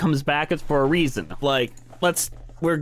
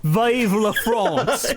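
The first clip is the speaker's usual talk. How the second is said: put-on British accent, slow, laughing, higher; 7 semitones higher, much slower than usual. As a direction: drawl Vive la France the English way, laughing. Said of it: "you have to say it in a British accent".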